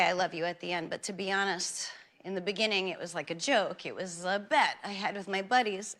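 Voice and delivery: mock heartfelt confession. Talk show host confessing to America, in a mock-tender low voice.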